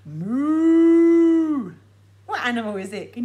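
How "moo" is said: drawn out reveal. Shown as a demonstration. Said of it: "the answer".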